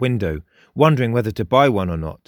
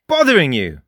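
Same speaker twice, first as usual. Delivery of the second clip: a raised indignant echo; exclaiming; 4 semitones higher, 5 dB louder than usual. A narrator's reading. Frost exclaims at Jake, mildly raised. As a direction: toss the words back at him. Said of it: "exclaimed the boy".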